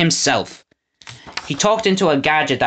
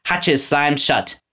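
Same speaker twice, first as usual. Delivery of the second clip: ordinary delivery, nothing marked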